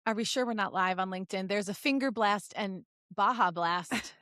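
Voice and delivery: normal talking voice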